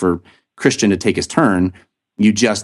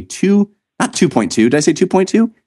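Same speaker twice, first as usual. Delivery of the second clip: rising high in disbelief at own slip; not two point two, then a high incredulous Did I say two point two; self-mocking surprise; 10 semitones higher than usual. Real, not acted. Host catching his own mistake on air, mock disbelief. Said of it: "or excuse me".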